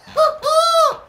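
high, loud burst of laughter; ecstatic laugh. Real, not acted. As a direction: laugh in pure disbelief at the goal. Laughing after the goal.

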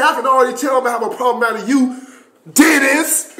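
fast, high mock-sergeant warning; single out a recruit, imitated; high sergeant voice, hard on the name; drill sergeant. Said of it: "little dude that was yelling".

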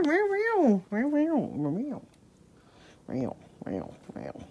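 soft mock meows, fading away; soft cat meows trailing off; imitating meows. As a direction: meow softly to lure the cat. Meowing to call the cat.

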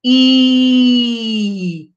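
loud, held "I" with teeth together; vowel I, teeth together. Shown as a demonstration. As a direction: spread the lips and hold the "I". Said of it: "os dentinhos ficam juntinhos".

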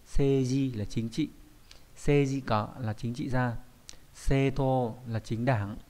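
each Japanese word read out slowly and clearly, Vietnamese gloss after; slow vocabulary reading. Shown as a demonstration. Teacher reading kanji vocabulary to students, slow and clear. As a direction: read the list slowly so learners can repeat. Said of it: "teacher reads vocabulary list slowly".

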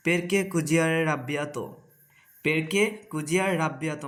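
very slow, every syllable spaced; slow demo. Shown as a demonstration. Teacher to Bengali learners, very slow demo. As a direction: model the phrase slowly so learners can copy. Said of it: "teacher models the Italian phrase".

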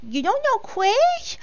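high, drawn-out scandalised question; mock disbelief. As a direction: ask it high and slow, utterly scandalised. Mock disbelief at not knowing someone.

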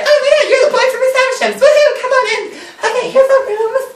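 very high, rushed falsetto gushing; mock giddy welcome. Herbert welcoming Pip to his rooms, mock giddy falsetto. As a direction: gush in a squeaky, hammed-up voice.

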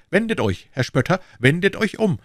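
high, loud call after a mocker; furious young swordsman calling out; challenging shout. A narrator's reading. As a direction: shout him down and make him turn. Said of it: "folgte ihm nach und rief".

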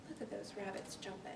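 a hushed, fast little aside. Real, not acted. Aside to listening children.

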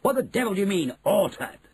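high-pitched blustering question; indignant outburst, pitched well up; shouted indignation. A narrator's reading. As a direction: demand it in affronted disbelief. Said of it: "shouted Mister Jenkins".

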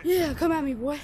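loud, high trash-talk yell; taunting shout. Pretend. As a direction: roar the taunt like a boxer. Continued taunting.

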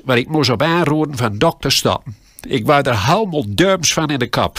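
ordinary talk

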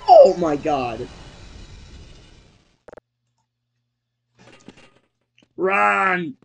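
loud frightened yell; panicked shout. Real, not acted. Sudden panic in game.

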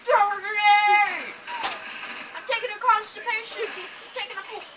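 high, loud mock straining; hammed-up constipated impression. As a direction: imitate someone yelling while constipated. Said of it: "sounds like he's taking a constipation while he says it".

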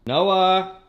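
sing-out call to a dog. Real, not acted. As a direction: call out for a pet, holding the last syllable. Calling out to find the dog.

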